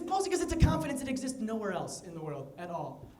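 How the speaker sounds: ordinary delivery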